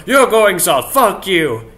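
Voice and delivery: loud burst of scorn; theatrical, loud flare of temper; angry outburst. Acted. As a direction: blurt it out as an acted insult. Acted scorn breaks through forced praise.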